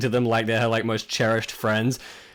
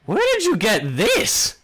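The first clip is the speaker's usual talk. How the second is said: much higher, slow, wide-eyed question; astonished question; 10 semitones higher, slower than usual. Acted. Tavern keeper staring at the expensive wine bottle, astonished. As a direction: ask it like you can't believe the price. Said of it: "looks at the bottle and goes".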